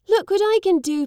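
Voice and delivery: put-on high, loud child shouting; a boy showing off for his father; boasting boy.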